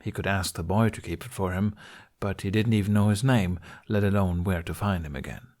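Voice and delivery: plain reading voice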